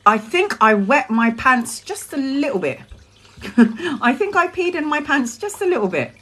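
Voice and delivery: ordinary talk, nothing marked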